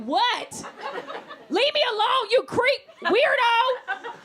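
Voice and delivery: high, loud, slow laughing shout; fed-up yell. Retold or imitated. Comic turns on the man following her, loud angry shout. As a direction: finally snap at the follower. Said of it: "I was like".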